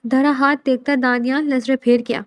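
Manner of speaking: ordinary delivery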